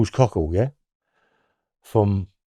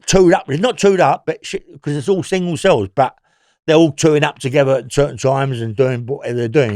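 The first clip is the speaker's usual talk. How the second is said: usual speaking voice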